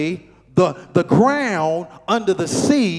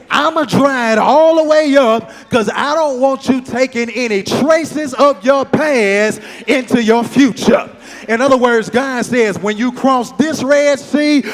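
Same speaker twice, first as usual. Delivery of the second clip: full-force preaching; 5 semitones higher, 6 dB louder than usual. Real, not acted. Preacher promising the congregation a clean future, impassioned high preaching. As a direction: drive each repeated phrase harder, end in a shout.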